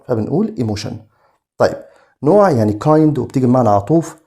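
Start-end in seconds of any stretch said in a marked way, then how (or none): 0.6-0.9 "emotion" ending in a SH sound, "imoshun"; tion as shun. Shown as a demonstration. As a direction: say the TIO in "emotion" as SH. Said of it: "ال تي آي أو إن بننطقها زي صوت الشن". ("emotion" بنطق TION زي صوت الشن)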